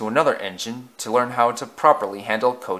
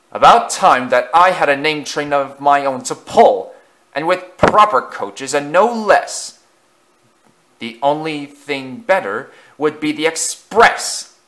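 loud, higher-pitched bragging; self-important, raised-voice crowing; loud boasting; 3 semitones higher, 6 dB louder than usual. A narrator's reading. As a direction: boast loudly so the whole shed hears. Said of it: "he said loudly".

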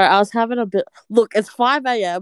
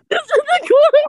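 laughing; 3 dB louder, faster than usual. Real, not acted. Laughing at a friend's drawing.

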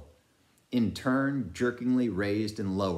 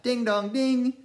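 bell imitation; 6 dB louder than usual. A narrator's reading.

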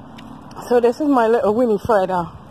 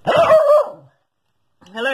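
much higher, louder sing-song "Hello"; bright greeting; 6 dB louder than usual. Real, not acted. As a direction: throw a big cheery hello to the viewers. Greets viewers at video start.

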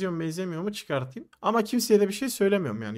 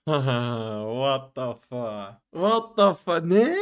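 drawn-out disbelief; 4 semitones lower, much slower than usual. Retold or imitated. Hearing the friend is Derya.

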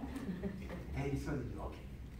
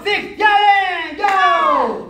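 very loud, raised-pitch command; drill shout; 5 semitones higher, 10 dB louder, much slower than usual. Real, not acted. Coach calls the final strike, full shout. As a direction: drive the class through the last count.

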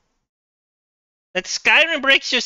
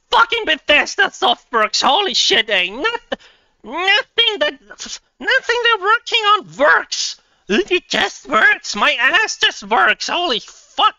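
exasperated high-pitched rant, dragging some words out; annoyed; 7 semitones higher, 3 dB louder than usual. Real, not acted. Gamer venting at developers whose game broke the computer, annoyed. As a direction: vent real annoyance, pitched up. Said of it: "I'm getting so annoyed".

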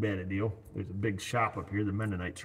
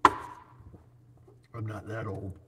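mock protest; 3 dB quieter, much slower than usual. Joking denial about age.

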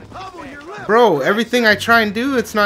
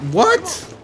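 higher, startled what; surprised what; 12 semitones higher than usual. Real, not acted. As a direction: yelp in confusion. Thrown off again.

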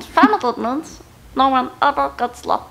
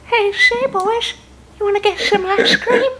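high cartoon voice with a chuckle; high, giggly cartoon character offer; Family Guy impression; 9 semitones higher than usual. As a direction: pitch it way up, cartoon style. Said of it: "Family Guy".

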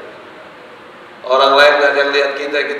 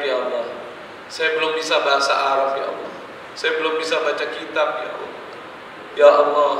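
quiet repentant prayer; 7 dB quieter than usual. Acted. Sinner confessing softly to Allah at night, hushed prayer. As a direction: murmur each confession as if alone at night.

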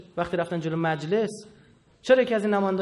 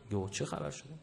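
ordinary talk

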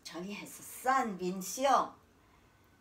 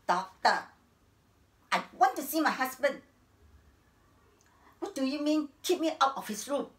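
loud, higher-pitched insistence; indignant demand; 3 semitones higher, 6 dB louder than usual. Acted. Wife demanding the doctor let her see her husband, indignantly. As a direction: confront them, outraged and forceful.

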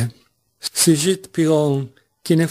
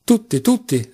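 eager, high-pitched exclamation; rally everyone, voice lifted high; exclaiming; 8 semitones higher than usual. A narrator's reading. Mitya calls everyone into the toast, exclaiming eagerly. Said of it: "esclamava Mitya".

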